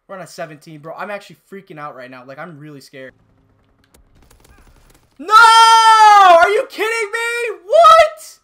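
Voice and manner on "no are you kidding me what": very high, very loud disbelieving screams; outraged scream. Real, not acted. Gamer losing his streak to a pistol, outraged scream.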